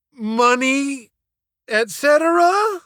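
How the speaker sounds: high, uncertain "Money, et cetera?"; deeply doubtful question. Acted. Jimmy unsure of his answer, asking the teacher with high doubt. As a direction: guess it with huge doubt. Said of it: "with a tripled question mark of doubt".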